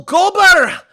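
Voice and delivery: high, slow Scottish blacksmith voice; Gobber voice. Acted. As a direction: play the jolly Viking smith, drawn out. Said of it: "sounds like Gobber".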